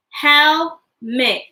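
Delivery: HELMET split into two separate beats; broken into syllables. Shown as a demonstration. Teacher breaking words into parts with children, Helmet split in two. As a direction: say Helmet in two parts, pausing between them. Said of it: "Break words into parts".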